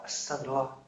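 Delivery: very soft, low murmur; repentant. Acted. Preacher models seeking forgiveness after complaining, hushed. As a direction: breathe a quiet plea for forgiveness. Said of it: "Harusnya Bapak Ibu istighfar".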